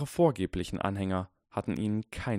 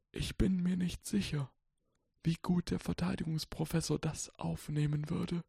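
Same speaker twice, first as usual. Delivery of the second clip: put-on softly reciting old wizard; 10 semitones higher, 4 dB quieter than usual. A narrator's reading. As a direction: murmur it like ancient verse. Dumbledore voiced in story.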